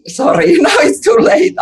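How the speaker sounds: cheeky defiant laugh at parents; laughing mock-defiant refusal; laughing defiance.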